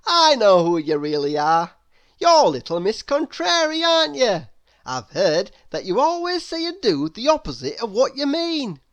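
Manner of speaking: a stout friendly man correcting her; Mister Happy. A narrator's reading. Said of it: "he smiled".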